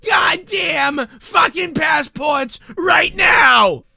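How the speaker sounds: high, slow, hammed-up shouting demand; mock furious shout. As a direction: rant like an outraged customer at full volume. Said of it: "waltz around the fucking place being like".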